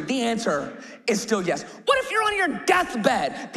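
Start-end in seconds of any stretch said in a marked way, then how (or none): 1.9-3.5 preaching shout. Real, not acted. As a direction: shout the question to the crowd. Preacher's rhetorical build-up.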